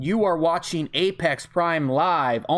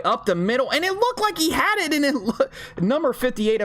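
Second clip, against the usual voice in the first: sudden high-pitched surge; startled, thrilled goal-line call; excited play-by-play; 10 semitones higher than usual. Real, not acted. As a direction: jump up in pitch for the near score. Live call of near touchdown.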